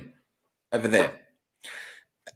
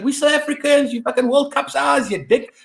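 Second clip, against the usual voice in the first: mock patriotic boast; 8 semitones higher than usual. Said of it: "you're going".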